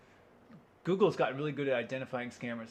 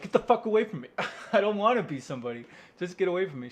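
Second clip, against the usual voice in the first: loud, raised-pitch protest with a laugh; mock angry rejection; 4 semitones higher, 5 dB louder, faster than usual. Loner to people who love him, mock angry rejection. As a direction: shove them off loudly, half laughing.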